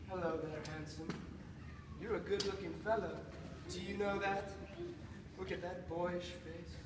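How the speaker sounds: very soft, low coaxing; tender flattery. Acted. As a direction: comfort and praise a frightened creature. Soothes creature with praise.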